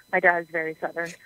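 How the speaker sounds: ordinary talk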